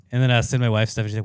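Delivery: ordinary talk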